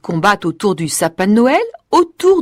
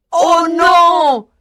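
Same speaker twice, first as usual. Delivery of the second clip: mice crying out; 7 semitones higher than usual. A narrator's reading. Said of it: "crient les grosses souris".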